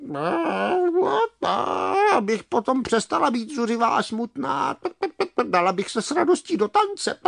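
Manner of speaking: high, clucking hen voice, hopeful; hen cheering up. Acted. Hen promising to dance for joy, hopeful clucking. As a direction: cluck it high, warming to the idea.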